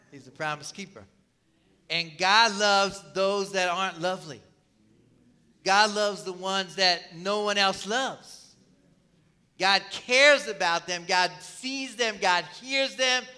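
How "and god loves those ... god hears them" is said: slow, firm, rising sermon close; preaching build. Acted. Preacher closing the sermon to the congregation, slow and building. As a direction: lay each line down slowly, building.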